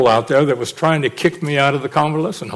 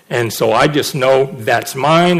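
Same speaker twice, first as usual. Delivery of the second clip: ordinary talk, nothing marked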